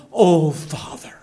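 whispered "O Father" full of longing; whispered prayer. Shown as a demonstration. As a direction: breathe O Father like an intimate prayer. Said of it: "You can see the emphasis and the emotion".

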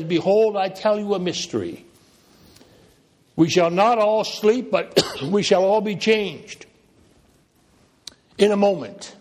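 high, loud, measured proclamation; proclaimed scripture reading. Real, not acted.

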